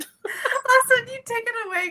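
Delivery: high-pitched, laughing handover; still laughing. Real, not acted. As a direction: squeeze the words out through real laughter. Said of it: "I'm still laughing".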